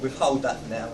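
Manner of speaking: usual speaking voice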